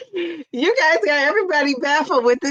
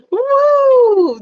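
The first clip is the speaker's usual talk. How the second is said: cheering woo; 13 semitones higher, 8 dB louder than usual. Real, not acted. Cheering a viewer's comment.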